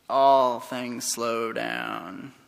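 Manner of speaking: very slow, low, soft drawl. Acted. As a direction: stretch it out like slow motion. Acts out time slowing down.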